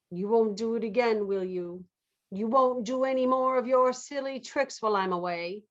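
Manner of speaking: ordinary talk, nothing marked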